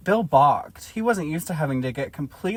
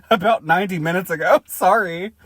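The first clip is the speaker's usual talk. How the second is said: laughing through line; 4 semitones higher, 7 dB louder than usual. Acted. Bill's embarrassed answer, laughing.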